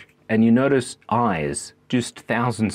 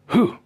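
owl who; 9 semitones higher than usual. Pretend. Owl hooting the dad joke punchline. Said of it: "pretending to be an owl".

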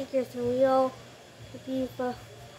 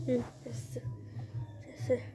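unvoiced whisper; whispered jester; 2 semitones lower, 15 dB quieter, faster than usual. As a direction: whisper your jester line.

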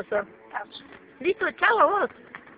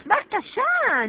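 ordinary talk

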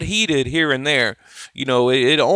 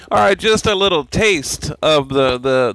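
usual speaking voice

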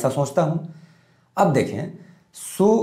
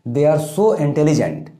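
slow dictation; much slower than usual. Shown as a demonstration. Teacher dictating model sentence.

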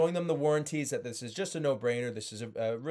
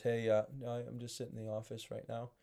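lower, much softer, deflated phone opener; low monotone; 7 dB quieter than usual. Shown as a demonstration. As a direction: mumble it down low with no energy. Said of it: "And some people are just".

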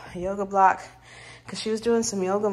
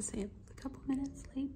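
whispering; 6 semitones higher, 17 dB quieter than usual. Real, not acted. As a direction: whisper it so she can't hear. Quiet aside in a salon.

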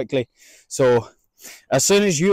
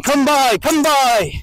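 high, loud calls to the dog; bright herding call; called dog command; 11 semitones higher, 4 dB louder, much slower than usual. Shown as a demonstration. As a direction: call it out across the field. Calling dog in demo.

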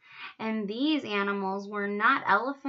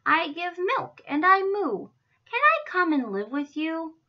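higher, louder cow asking eagerly; hopeful cow; 6 semitones higher, 5 dB louder than usual. A narrator's reading. Miss Moo asks giraffes for a home, hopeful. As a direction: ask eagerly to be let in.

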